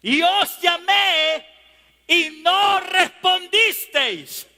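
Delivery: slow, high preaching cry; thundering scripture. Acted. God reproaching his people through Jeremiah, thundering preaching. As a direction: declaim it loudly, stretching each word.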